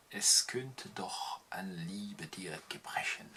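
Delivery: slow whisper to close; slow hushed closing whisper. Acted. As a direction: let the final verse fade into a whisper. Whispered final verse.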